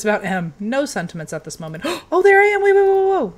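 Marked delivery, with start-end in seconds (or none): 1.8-3.3 high, loud gasp then rapid waits; excited gasp. Real, not acted. Player spotting her own ghost on screen, excited gasp. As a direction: yelp with delight at the discovery.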